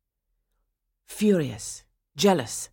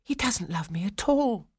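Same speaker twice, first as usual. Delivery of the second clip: high, forlorn inner voice; forlorn thought; 5 semitones higher than usual. A narrator's reading. Swanhilda deciding Franz doesn't love her, forlorn. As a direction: think it aloud, lifted and hurt. Heroine concludes she is unloved.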